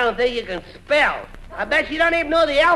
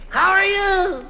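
calling out; 9 semitones higher, slower than usual. Acted. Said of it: "just listen to this echo".

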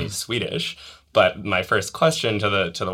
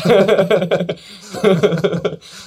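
long high burst of laughter; 11 semitones higher, 7 dB louder than usual. Real, not acted. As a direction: laugh it out for real. Laughs at Boston stereotype.

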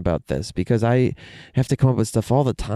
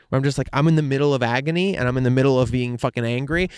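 ordinary talk, nothing marked

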